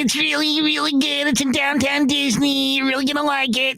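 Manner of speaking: high-pitched, gushing colleague impression; Ralph. Colleague selling the restaurant to his friend, high impersonated voice. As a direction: hammer each recommendation in his high put-on voice. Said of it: "He's like".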